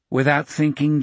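usual speaking voice

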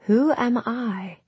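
put-on slow, high, lost old voice; frail old woman. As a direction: ask it like a frightened old woman. Confused dying grandmother speaks.

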